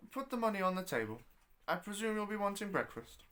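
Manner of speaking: put-on brusque old landlady. Landlady ordering the late guest to pay, curt and quick. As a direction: rattle off orders without looking up.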